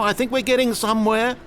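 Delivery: high, eager, rising at the end; excited. Acted. Narrator sensing progress with the player, excited. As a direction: say it with mounting excitement, pitched high. Same line, now carried away.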